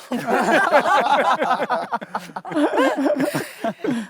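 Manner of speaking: bursts into giggles at the offer; laughing. Real, not acted. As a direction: laugh it off, a bit high.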